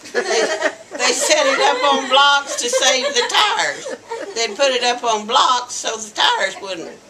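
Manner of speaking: real laughter running through the words; laughing. Real, not acted. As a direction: tell the punchline while laughing. Laughing at car on blocks story.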